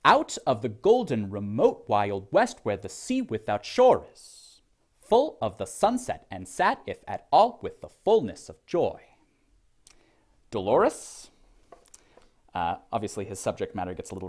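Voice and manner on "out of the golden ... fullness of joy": rolling dactylic verse, first beat of each three punched; exaggerated metrical reading, stressed syllables leaping up; overemphasized stresses. Shown as a demonstration. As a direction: recite with a hard swing on OUT, GOLDEN, MOTE, WEST. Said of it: "I'll overemphasize".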